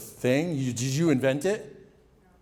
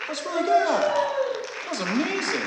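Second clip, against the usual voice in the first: thrilled praise; 13 semitones higher than usual. Real, not acted. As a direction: gush over the performance in real awe. Host reacts to opera singing.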